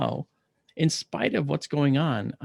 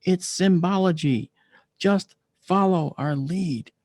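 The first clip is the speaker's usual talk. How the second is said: slow, emphatic mock yell, pitched up; retold scream; 5 semitones higher, slower than usual. Kid into the patient's face, mild retold scream. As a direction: spell it out slowly and forcefully, raised pitch. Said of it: "and he screams".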